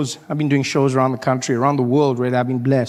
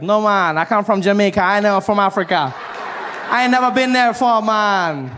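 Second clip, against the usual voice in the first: Jamaican guy; 7 semitones higher than usual. Retold or imitated. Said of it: "Guy's like".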